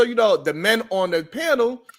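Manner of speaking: normal talking voice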